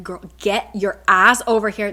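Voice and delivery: loud urgent call across a bridge. Retold or imitated. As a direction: yell at your friend to hurry up. Nesta calling Bryce across bridge.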